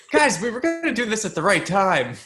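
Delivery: high-pitched mock exasperation, half laughing; Stanley Tucci. Dad to family about the adoption secret, mock exasperation. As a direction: play a hammed-up dad scolding the family. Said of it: "then Stanley Tucci goes".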